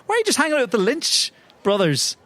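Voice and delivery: ordinary talk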